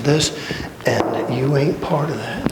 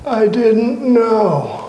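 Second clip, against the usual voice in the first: mock excuse; 9 semitones higher, 3 dB louder, much slower than usual. Mocking excuse of a generation.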